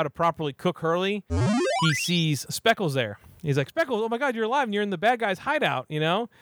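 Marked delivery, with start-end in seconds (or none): 3.7-5.9 high, amazed mock gasp; hammed-up surprise at a friend alive; mock astonishment. Hurley seeing Speckles alive, high amazed voice.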